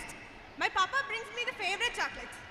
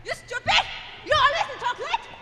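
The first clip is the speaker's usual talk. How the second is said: father yelling, much higher and louder; a drunk dad snapping at his daughter; furious father; 5 semitones higher, 5 dB louder than usual. Acted. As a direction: blow up at the kid. Father explodes at the child.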